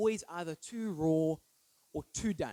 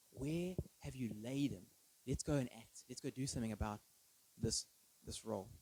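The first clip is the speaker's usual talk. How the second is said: soft Jesus voice; 5 semitones lower, 6 dB quieter than usual. Acted.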